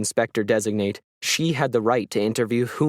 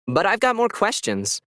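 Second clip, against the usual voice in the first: higher, indignant objection; protesting; 9 semitones higher than usual. A narrator's reading. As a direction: push back against being cut off. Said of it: "Gwen began to protest".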